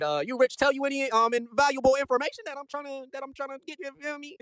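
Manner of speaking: high, hesitant, wheedling question; coaxing probe. Acted. Recapper as Jameson pumping Peter for information, high wheedling tone. Acted fishing for information, feigned casualness.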